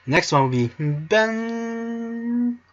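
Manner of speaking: Ben held high and slow. Shown as a demonstration. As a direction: Ben wysoko i powoli.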